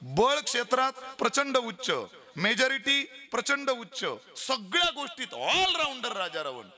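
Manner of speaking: high, slow building praise; fervent preaching. Real, not acted. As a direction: preach it with rising fervour. Preaching at full force.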